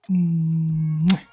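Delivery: usual speaking voice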